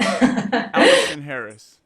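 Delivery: loud, slow mock-British accent; imitated British accent. Host copying guest's name, loud drawn-out British accent.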